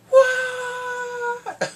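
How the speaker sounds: high, drawn-out loud "what"; shocked cry. Acted. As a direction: wail it in mock disbelief. Shocked reaction to the reveal.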